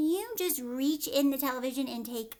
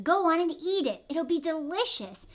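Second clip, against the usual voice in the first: excited urging; 5 semitones higher, 6 dB louder than usual. Acted. Wonka urging Charlie.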